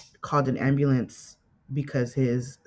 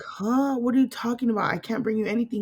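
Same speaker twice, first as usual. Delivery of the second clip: higher-pitched, incredulous retort; baffled protest; 8 semitones higher, faster than usual. Retold or imitated. Daughter answering dad's impossible request, baffled protest. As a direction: imitate a confused, disbelieving reply.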